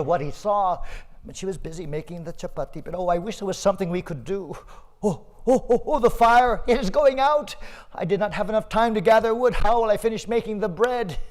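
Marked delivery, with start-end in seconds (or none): 2.9-11.2 louder, higher fretting; worried mother. Acted. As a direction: fret as the fire dies. Mother frets over fire.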